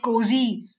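ordinary delivery

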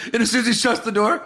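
ordinary talk, nothing marked

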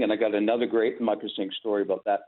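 ordinary talk

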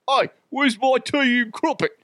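put-on high-pitched caricature British accent; attempted British accent. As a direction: ham up a bad British accent. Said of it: "I'm British".

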